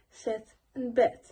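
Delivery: "set and bed" with short e vowels, as if for sit and bid; South African vowel. Shown as a demonstration. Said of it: "So you say it like".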